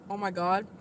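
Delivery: normal talking voice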